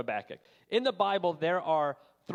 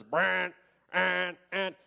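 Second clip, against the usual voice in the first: a mock blaring alarm, a bit higher and louder; imitated alarm; 6 semitones higher, 3 dB louder, much slower than usual. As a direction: blare it like a fire alarm going off. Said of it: "the loudest alarm I've ever heard is".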